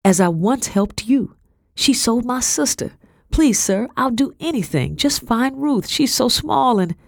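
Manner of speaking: high, urgent plea; pleading. A narrator's reading. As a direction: beg desperately for your sister's sake, voice pitched high. Girl begs colonel for help.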